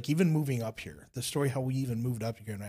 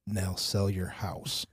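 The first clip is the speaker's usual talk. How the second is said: slow God voice; 5 semitones lower, much slower than usual. Retold or imitated. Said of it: "God literally was like".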